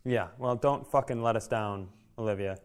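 ordinary delivery

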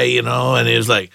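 usual speaking voice

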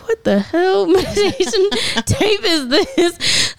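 high, slow, laughing question; laughing disbelief. Retold or imitated. To her doula about Oprah's voice, laughing.

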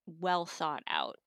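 ordinary talk, nothing marked

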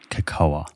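cocoa said kuh-KOH-uh; "cocoa" in three syllables, kuh-KOH-uh; chosen pronunciation. Shown as a demonstration. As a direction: sound the A ending "cocoa". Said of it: "there is an A on the end of it".

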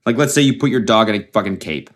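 ordinary talk

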